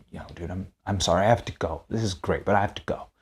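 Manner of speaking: very soft, low aside; hushed, low-key acted apology; unbothered apology. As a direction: excuse yourself quietly, retelling your own reply. His unbothered apology to angry man.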